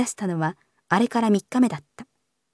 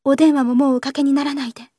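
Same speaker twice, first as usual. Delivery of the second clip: higher-pitched, earnest request; embarrassed plea; 5 semitones higher, 4 dB louder than usual. A narrator's reading. Young lady asking him to stop phoning, embarrassed pleading. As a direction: beg him, abashed but insistent. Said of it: "言いながら令嬢は赤くなった".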